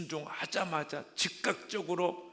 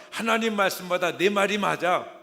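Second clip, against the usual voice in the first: much louder mock proclaiming with 하나님 stressed; hammed-up agreement; 6 semitones higher, 12 dB louder than usual. Believer siding with Satan over God, loud hammed-up claim. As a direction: push 하나님 out loud and falling.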